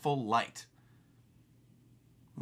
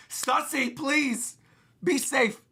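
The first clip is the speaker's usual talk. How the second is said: pleading; 10 semitones higher, much slower than usual. Acted. Vegapunk pleading with Stussy.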